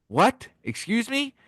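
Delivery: high, rising disbelief; acted baffled yelp; incredulous. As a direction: question it as if you can't believe it. Acted man baffled by the answer.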